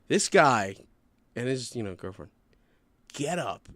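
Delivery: ordinary delivery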